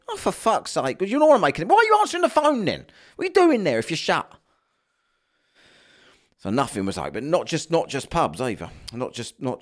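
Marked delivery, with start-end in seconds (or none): 0.1-4.3 high, incredulous comic rant; exasperated rant. Retold or imitated. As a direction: demand an explanation in mock exasperation. Acted rant at closed pub.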